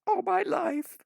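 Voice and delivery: put-on high, loud girl voice; a high girl voice near tears; tearful girl. A narrator's reading. Orphan girl telling Adam of her whole life, high voice. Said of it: "She sniffs and says".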